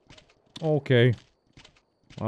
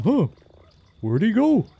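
high, louder puzzled guard; mock guard voice; 9 semitones higher, 5 dB louder than usual. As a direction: play the confused guard. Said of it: "It's like".

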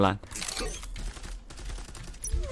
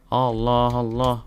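normal talking voice

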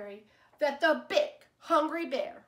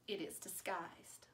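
breathy storyteller whisper; suspenseful whisper; 7 semitones lower, 15 dB quieter than usual. A narrator's reading. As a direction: whisper it like a secret.

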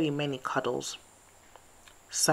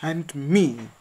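shouted; 7 dB louder than usual. A narrator's reading. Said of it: "shouted Owl".

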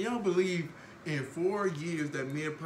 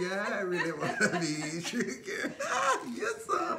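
laughing; 3 semitones higher, slower than usual. Real, not acted. Joking about wanting chicken.

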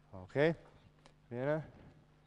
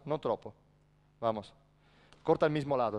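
ordinary talk

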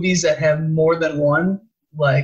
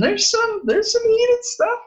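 high-pitched gossip. Real, not acted. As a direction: voice jumps way up with glee. Gossip about heated comments.

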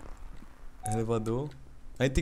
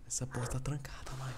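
whispering; 3 semitones lower, 21 dB quieter than usual. Real, not acted. Hiding from monster.

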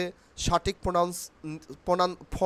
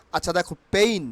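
ordinary talk

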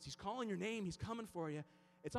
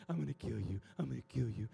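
coaxing threat. Retold or imitated.

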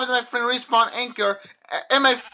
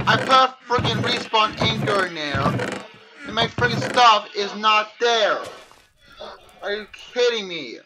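normal talking voice

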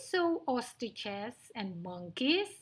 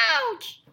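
high, loud little squeal; squeal of pain; 13 semitones higher, 6 dB louder than usual. Acted. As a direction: squeal like a swatted mouse. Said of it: "squealed Midgie".

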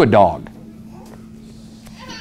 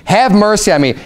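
higher-pitched mock plea; crying out, retold; 7 semitones higher than usual. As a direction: call after them for mercy, without real tears. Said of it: "she's crying after the disciples".

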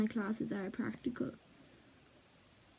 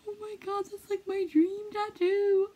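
excited; 9 semitones higher than usual. Real, not acted. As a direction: gush over your dream tattoo. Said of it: "I'm so excited".

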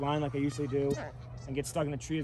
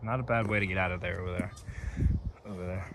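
golf commentator; 6 semitones lower, 4 dB quieter than usual. Pretend.

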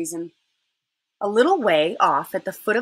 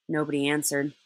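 plain reading voice

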